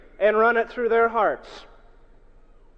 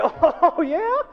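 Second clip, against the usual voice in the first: high, loud, incredulous jeer; mocking disbelief; 9 semitones higher, 6 dB louder, slower than usual. Old Harry behind the hand-raiser, loudly incredulous. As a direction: scoff with a swooping high voice.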